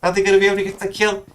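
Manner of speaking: high-pitched, urgent match commentary; excited play-by-play. Real, not acted. Caster wonders if he gets the flush, excited. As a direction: call it in suspense, voice pitched right up. Live play-by-play under suspense.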